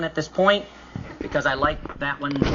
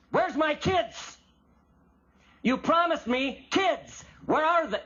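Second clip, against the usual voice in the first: high-pitched, drawn-out indignant complaint; street-talk demand; 9 semitones higher, slower than usual. Acted. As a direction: confront God bluntly, stretching the key words. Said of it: "It's street talk".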